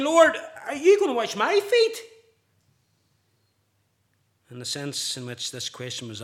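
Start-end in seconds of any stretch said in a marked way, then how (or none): 0.0-2.0 high, loud, incredulous cry of Lord are you gonna wash my feet; astonished disbelief. Retold or imitated. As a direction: voice Peter's shocked disbelief, pitched up and loud. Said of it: "and saying".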